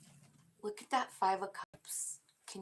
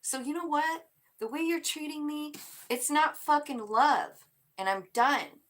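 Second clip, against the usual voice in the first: higher and louder, fed-up and final; defiant outburst; 8 semitones higher, 7 dB louder than usual. Acted. Woman done with a cheating partner, loud defiant outburst. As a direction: slam the door on him.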